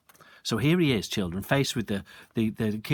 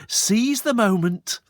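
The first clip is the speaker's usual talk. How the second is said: seize the moment; 10 semitones higher, 6 dB louder, slower than usual. A narrator's reading. Storyteller recalls own bold thought.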